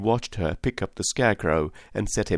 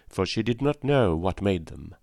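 plain reading voice

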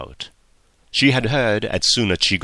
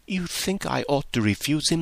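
a hint of a higher, dismayed young voice; lightly voiced anxious girl; 6 semitones higher, 4 dB quieter than usual. Harriet realises Emma means refusal, lightly dismayed. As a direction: ask it with quiet dismay. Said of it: "Harriet reacts to Emma's hints".

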